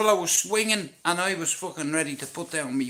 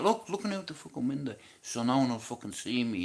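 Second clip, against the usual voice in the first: softer, quicker telling of peeking out; sneaky hush; 4 semitones lower, 5 dB quieter, faster than usual. Retold or imitated. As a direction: drop your voice like you're hiding. Sneaking a look out window.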